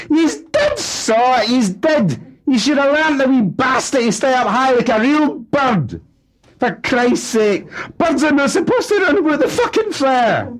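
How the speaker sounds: very high, loud grieving rant; father raging over dead budgie; angry ranting. Acted. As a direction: howl blame at them. Father ranting in family row.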